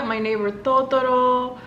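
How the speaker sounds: ordinary talk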